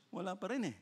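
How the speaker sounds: softly voiced, matter-of-fact answer; understated reply. God answers Abraham that none are found, quiet reply. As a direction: let the refusal drop quietly.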